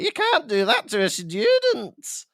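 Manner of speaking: high, drawn-out scandalised scolding; hammed-up outraged schoolteacher; McGonagall. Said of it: "McGonagall's like".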